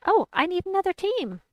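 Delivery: high, loud, startled exclamation; sudden realisation. Real, not acted. Player realising a team is missing, startled high outburst.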